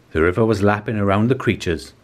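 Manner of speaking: usual speaking voice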